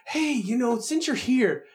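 high, casual asking voice; imitating coworker. Coworker cornering the IT tech, mock casual. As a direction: ask sweetly for a favor.

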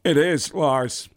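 slow, halting Walken cadence; Christopher Walken impression. Prank caller greeting Lars as Christopher Walken, slow and halting. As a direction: drag out each phrase like Walken. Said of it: "Can you say hi to Christopher Walken".

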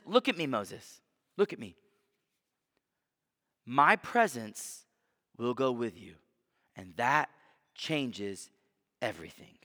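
calm him down, voice sinking to a hush; tender reassurance. Retold or imitated. God answers Moses tenderly.

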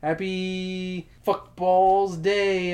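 slow, loud, stretched greeting; drawn out. Pretend. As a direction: savour each word of the toast. Drawn-out mock celebration.

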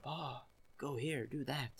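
hushed, slow tutorial voice; voice in the head. Acted. Voice in the player's head giving directions, hushed and slow. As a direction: softly order the player around, drawn out. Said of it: "du har röster i huvudet".

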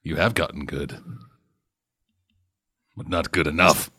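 low, soft menacing master; booming echoing villain monologue. Acted. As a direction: sneer it like a martial arts villain. Master taunts gator.

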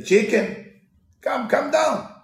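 loud, high wolf calling; coaxing wolf. Retold or imitated. Wolf coaxes chicken back after dogs leave, loud. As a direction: call her down sweetly.

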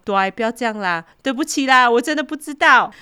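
a thief begging the father, high whiny mock sob; mock crying plea. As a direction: beg like a thief caught red-handed, fake tears. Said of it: "小偷哭着说".

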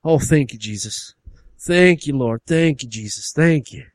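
fervent praise in bursts, each thank-you lifted; revival-meeting gratitude, hammed-up; hillbilly brother. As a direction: thank the Lord like a backwoods believer. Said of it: "the hillbilly brother's like".

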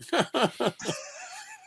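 high rising burst of laughter; a squeal of laughter. Real, not acted. Laughing at trying to pry the mailbox open, high squealing laugh.

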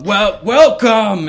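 big, slow booming host voice, high and loud; hearty welcome. Acted. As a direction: greet them loudly like a huge jolly host. Ogre host greets guests.

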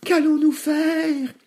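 very high, drawn-out piglet wail; alarmed outcry. A narrator's reading. Two frightened pigs asking their brother, high outcry. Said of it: "s'écrièrent Nif Nif et Naf Naf".

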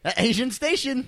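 hammed-up radio presenter. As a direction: announce it like a cheesy radio host. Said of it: "radio host bit".

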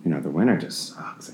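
ordinary talk, nothing marked

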